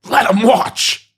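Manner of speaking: high, drawn-out mock angry cry; Grimace, real mad. Grimace protests to the stadium, hammed-up angry cry. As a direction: bellow it slowly, high and hammed-up. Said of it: "Grimace gets real mad".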